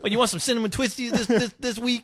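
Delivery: high, fast drive-thru worker; cashier voice. Pretend. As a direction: chirp it like a cashier.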